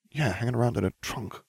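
soft, under the breath; muttered. A narrator's reading. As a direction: mutter it aside. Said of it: "Ron muttered".

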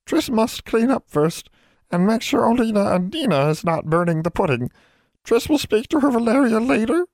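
a tiny elf begging to be excused; pleading house elf. A narrator's reading. Elf pleading with Valeria before leaving. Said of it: "said in a pleading tone".

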